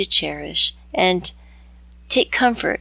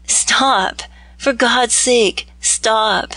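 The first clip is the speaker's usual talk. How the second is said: slow, urgent cry to stop her; hurt man cries out to his beloved to stop; 4 semitones higher, 3 dB louder, much slower than usual. A narrator's reading. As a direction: plead with her to stop, a little higher and slower. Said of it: "cried Brandon".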